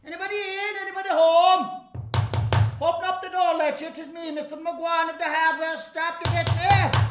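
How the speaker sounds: loud, high, hearty country shopkeeper shouting through a door; shopkeeper calling through the door. Acted. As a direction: bang on the door and bellow cheerily.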